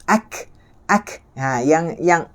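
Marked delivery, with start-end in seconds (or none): 0.1-1.2 "ak" with a windy k; airy kaf. Shown as a demonstration. Teacher shows the wind sound, airy "ak". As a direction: release "ak" in a puff, no bounce. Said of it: "keluar sini dah bunyi angin".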